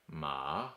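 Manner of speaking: "ma" dipping low, then rising; third tone. Shown as a demonstration. Teacher demonstrating ma for horse, dipping tone. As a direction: draw a check mark with "ma". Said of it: "let's do them all together".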